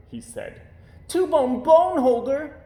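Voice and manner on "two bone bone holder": high, loud and slow disbelief; aghast at the dog's idea; cried in dismay. A narrator's reading. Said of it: "cried Little Bear in dismay".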